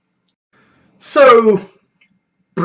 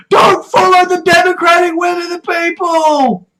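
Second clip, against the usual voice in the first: mock outrage; 6 semitones higher than usual. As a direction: parody the angry voters, building to a yell. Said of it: "And it's like".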